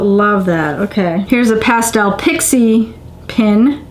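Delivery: normal talking voice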